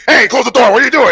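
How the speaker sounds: loud, high mock outrage; hammed-up indignant shout; retold yell. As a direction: holler it like someone caught out. Acted yell at open closet door.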